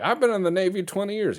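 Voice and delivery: high, fast indignant protest; indignant sailor. Retold or imitated. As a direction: protest like an offended old navy man. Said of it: "They're like".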